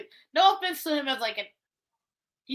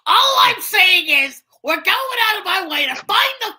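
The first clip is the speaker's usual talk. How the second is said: higher, louder, slow grating yell; Gilbert Gottfried impression; 6 semitones higher, 7 dB louder than usual. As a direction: yell it in a screechy comic rant. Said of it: "Gilbert Gottfried as Charmy".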